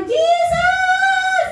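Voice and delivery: high, sung-out shout of love; shouting worship. Retold or imitated. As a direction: cry it out at the top of your lungs. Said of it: "Sumisigaw pa".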